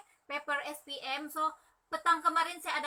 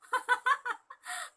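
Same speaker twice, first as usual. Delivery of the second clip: a high, bright burst of laughter; 11 semitones higher than usual. Real, not acted. Seller laughing at her own joke to viewers, high laugh. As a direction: laugh off the mock threat.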